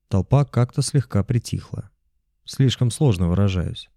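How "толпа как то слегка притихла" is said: usual speaking voice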